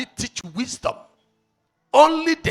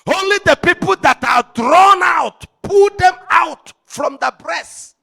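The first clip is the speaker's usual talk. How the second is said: preaching at full force; 9 semitones higher, 8 dB louder than usual. Acted. Preacher roaring his message at the congregation, full force. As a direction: thunder it out to the congregation.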